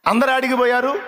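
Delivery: high, eager questioning voice with అందరూ lifted; foolish servant's question. Acted. Servant asks the dying king, high naive voice. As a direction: play the simple fool asking innocently.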